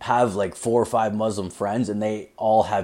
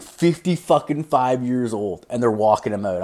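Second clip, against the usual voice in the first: normal talking voice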